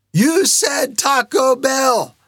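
high, very drawn-out call; mock protest cry. The Lord suggests echoing the kids, called-out protest. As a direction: echo the kids' demanding cry.

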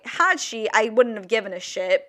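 ordinary delivery

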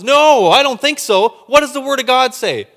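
loud, high, defiant no; loud defiant refusal; defiant rebuke. Acted. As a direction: shout down the doubt. Acting faith rebuking worry.

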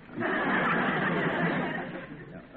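a burst of high, breathy laughter. Acted. Friend laughs at wife's joke.

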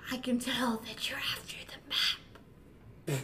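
slow, hushed spooky whisper; ghostly whisper. Acted. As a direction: breathe it like a ghost.

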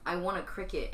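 ordinary talk, nothing marked

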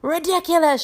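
high shouted spell; Seamus. A narrator's reading. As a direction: shout the spell, pitched right up. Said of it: "shouted Seamus".

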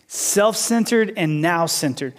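slow repeat with "Self-centered" stressed and falling; emphatic slow repeat. Shown as a demonstration. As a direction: spell it out slowly.

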